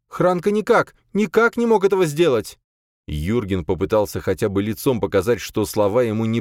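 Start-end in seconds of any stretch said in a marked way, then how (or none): 0.1-2.6 high, loud, urgent defence; anxious insistent denial; frightened protest. A narrator's reading. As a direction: plead for him in an alarmed high voice. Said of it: "Боя испуганно вытянулась".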